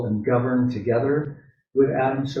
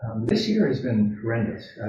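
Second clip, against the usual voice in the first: ordinary delivery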